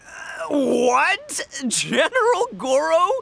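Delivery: stunned disbelief. Retold or imitated. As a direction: act out the jaw-drop moment of hearing the news. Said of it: "I was like".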